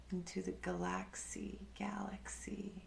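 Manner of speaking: breathy whisper fading into nothing. Acted. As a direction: let the words dissolve into a whisper.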